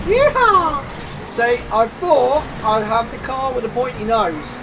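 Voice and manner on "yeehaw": loud, high whoop of joy; excited yeehaw. Real, not acted. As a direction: let out a big cowboy whoop. Excited cry while driving fast.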